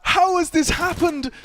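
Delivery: high, loud, stunned commentary; shocked excited shout. Real, not acted. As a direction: shout it in disbelief. Shocked caster outburst.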